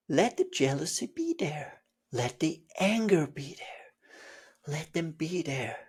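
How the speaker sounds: soft breathy whisper; gently allow it all; whispered guidance. Real, not acted. Teacher guiding acceptance, soft whisper.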